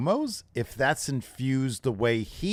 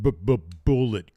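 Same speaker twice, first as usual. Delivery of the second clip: imitated stutter; 2 semitones lower, 4 dB louder than usual. Mimics stuttered entrance theme.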